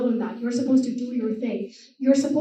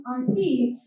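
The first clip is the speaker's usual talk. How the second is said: ordinary delivery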